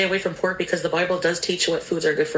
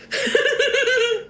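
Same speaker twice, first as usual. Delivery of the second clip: squealing laugh. Real, not acted.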